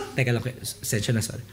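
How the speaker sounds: imitated low, soft apology; composed aside. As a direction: switch from weeping to a quiet excuse. Crying caller interrupts for phone.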